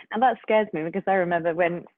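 ordinary delivery

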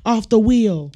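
hammed-up hostile officer; acted aggressive cop. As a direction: order the driver around like an angry cop. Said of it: "And they're like".

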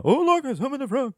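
very high mock wonder; feigned amazement. Magician pretends he conjured the frog, high feigned surprise. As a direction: squeal it as if amazed by your own trick.

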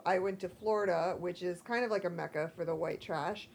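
ordinary delivery

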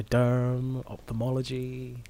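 usual speaking voice

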